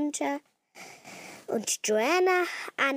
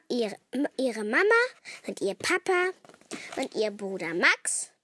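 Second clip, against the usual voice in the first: usual speaking voice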